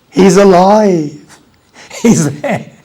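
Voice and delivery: joyful announcement. Acted. Joyful climax of story.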